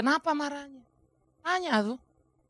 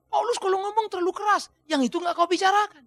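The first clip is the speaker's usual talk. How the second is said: higher mocking critic voice; 7 semitones higher than usual. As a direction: mimic the complainers with a sneer. Quoting critics of Paul. (menirukan pengkritik dengan nada tinggi mengejek)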